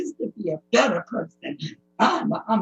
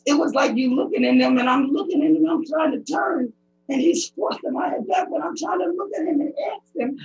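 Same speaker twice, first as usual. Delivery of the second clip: ordinary talk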